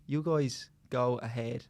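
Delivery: put-on slow, low, soft Senegalese accent; slow, weak Senegalese accent. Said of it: "That's my Senegalese accent".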